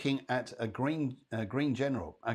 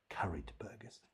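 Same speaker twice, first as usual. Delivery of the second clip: whisper; 4 semitones lower, 14 dB quieter than usual. Real, not acted. Joking farewell wish to viewers.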